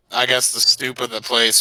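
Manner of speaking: ordinary talk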